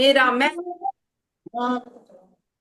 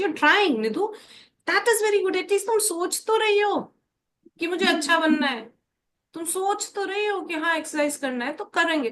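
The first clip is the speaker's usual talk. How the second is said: bright encouraging coach voice, higher; 6 semitones higher than usual. Acted. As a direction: cheer her on for trying.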